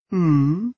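dreamy rising hum; hummed "hmm?". A narrator's reading. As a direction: hum a questioning reply. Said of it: "hummed Anya".

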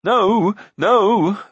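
high, loud wailing insistence; shrieky, wheedling cry; frenzied Glue. A narrator's reading. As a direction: plead with rising hysteria. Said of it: "shouting and wailing so loudly and rapidly".

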